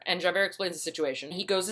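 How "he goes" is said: ordinary talk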